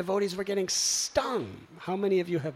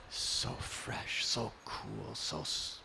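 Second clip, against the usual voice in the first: soft breathy delight at cool water; hushed savoring; 8 semitones lower, 10 dB quieter, much slower than usual. Retold or imitated. Devotee recalling the sea, hushed delight.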